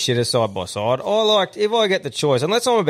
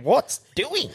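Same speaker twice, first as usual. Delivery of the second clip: confused bloke; 11 semitones higher than usual. Retold or imitated. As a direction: voice the baffled contractor. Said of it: "That bloke would be thinking".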